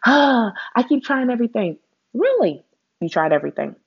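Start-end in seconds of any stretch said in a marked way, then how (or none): 0.1-1.8 higher, mock whiny complaining; whiny self-talk. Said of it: "that negative self voice that's like". 2.1-2.6 incredulous. Real, not acted. Scoffing at the complaint.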